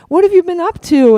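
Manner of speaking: high, loud, gushing interest; effusive question. Retold or imitated. Singer catching up with a fan, effusive question. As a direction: imitate an enthusiastic catch-up.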